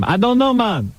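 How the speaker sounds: mock Jamaican accent. Host mimics the caller asked his last name, mock Jamaican accent. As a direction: imitate the caller in fake Jamaican. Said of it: "Worst Jamaican accent I ever heard".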